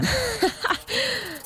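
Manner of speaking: short high scornful laugh; bitter laugh. Acted. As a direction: laugh in disbelief. Bitter reaction to being thankful.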